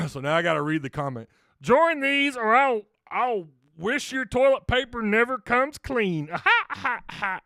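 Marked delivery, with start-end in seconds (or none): 1.6-6.3 higher, slow cartoon dad voice; Cleveland Brown. Host reading a Discord comment as Cleveland Brown. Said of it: "I'm trying to do Cleveland Brown". 6.3-7.4 high ha ha ha in character; cartoon laugh. Host laughing in Cleveland Brown voice. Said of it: "I'm trying to do Cleveland Brown".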